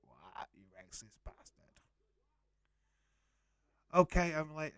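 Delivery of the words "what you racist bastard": hushed, under-the-breath mock accusation; mock outrage. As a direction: mimic the crowd's outrage in a hush. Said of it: "you'd all, like, be like".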